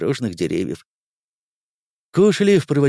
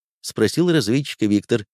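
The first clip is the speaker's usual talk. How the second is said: usual speaking voice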